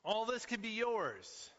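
high, slow grand offer; grand tempter. Pretend. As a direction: dangle the prize like a showman. Satan's temptation, salesman style.

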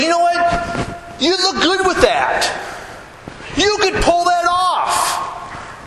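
high, slow, sugary mock approval; mock gushing praise. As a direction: ham up the fawning flattery. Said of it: "people will say".

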